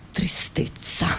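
hushed, voiceless aside; sad whisper. Acted. Comedian after quoting her children on dad's gifts, sad whisper. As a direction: drop to a desolate whisper.

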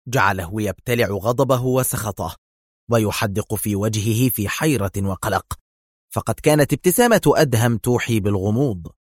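plain reading voice

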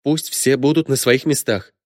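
mildly stern order; sudden hardness. A narrator's reading. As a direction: lay down the rule firmly. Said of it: "с неожиданной жёсткостью в голосе отрубила Ирина".

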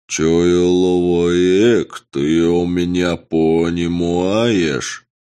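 slow, stretched, alien creature croak; drawn-out murloc voice. Acted. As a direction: drag every sound out like a fish-man. Murloc envoy speaks to hero.

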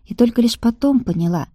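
ordinary delivery

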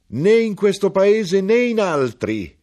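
very high, loud, slow cry; slow, high, ringing hawker's shout. Acted. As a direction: hurl it out like a street crier. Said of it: "egli gridò".

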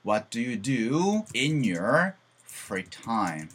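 stretched, word by word; very slow model sentence. Shown as a demonstration. Teacher modeling the question slowly to students. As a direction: lay out each word slowly. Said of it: "여가 시간에 뭐 하세요?".

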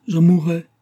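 high, loud angry outburst. A narrator's reading. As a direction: throw it out in acted anger. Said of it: "dit-il avec colère".

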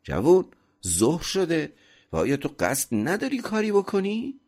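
high, loud master's call; narrated shout. A narrator's reading. Master calls to the servant still lying down, shouting. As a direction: raise pitch sharply, call out to the idler. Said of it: "پس فریاد زد".

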